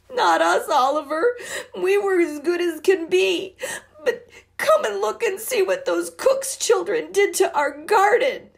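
put-on high, loud crying girl; sobbing. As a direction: cry out in upset. Both of the girls were just sobbing.

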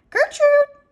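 high, loud parrot squawk; irritating parrot scream. A narrator's reading. Parrot screaming in the pet store, irritating screech. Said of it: "screamed again".

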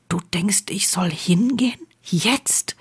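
soft whispered question; hushed and tentative, almost all breath; quiet, concerned. A narrator's reading. Harry checks he understood Ron, quiet and concerned. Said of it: "fragte er ruhig, besorgt".

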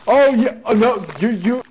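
excited. Real, not acted. Excited recognition.

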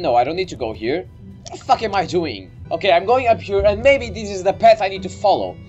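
ordinary talk, nothing marked

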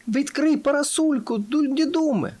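very high-pitched shouted request; urgent call. A narrator's reading. Friend calling to the camel under falling drops, urgent call.

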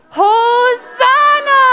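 long, high, loud celebratory shout; jubilant cheer. Shown as a demonstration. Teacher leading children in the special word, jubilant shout. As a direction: cry it out joyfully, drawn out.

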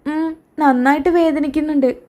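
put-on small, high child's voice; high-pitched child in pain; hurt little child. Hurt child answering in story.

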